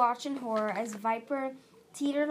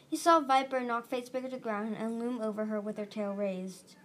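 plain reading voice

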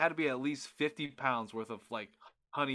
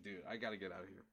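quiet mutter; 6 semitones lower, 13 dB quieter, faster than usual. Retold or imitated. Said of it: "I was like".

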